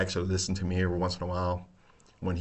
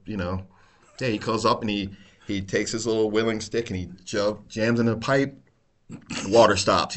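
ordinary delivery

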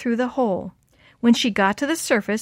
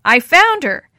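loud, higher announcing shout; 6 semitones higher, 9 dB louder, slower than usual. A narrator's reading. Maria tells the other searchers of the find, shouting. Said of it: "Maria shouted".

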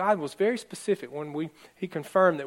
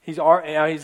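usual speaking voice

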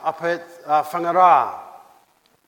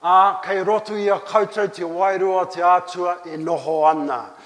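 usual speaking voice

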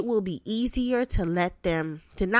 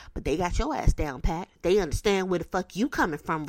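ordinary talk, nothing marked

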